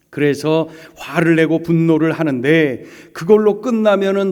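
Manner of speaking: fervent sermon build; preaching. Real, not acted. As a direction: drive the point home to the congregation. Preaching at full force.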